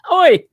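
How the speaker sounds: put-on punched, high "Oi", rhyming with boy; loud Yankee "oi". Shown as a demonstration. Driving the Northern vowel home after a laugh, a loud "Oi". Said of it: "We do "oi".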